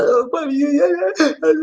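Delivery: badly faked tearful wail; mock crying. Host teasing listeners crying in the corner, mock sobbing. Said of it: "You crying. You up in the corner".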